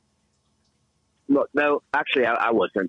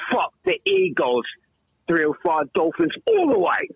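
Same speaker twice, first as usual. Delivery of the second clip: team cheer; 13 semitones higher than usual. Pretend. Rooting for his football team.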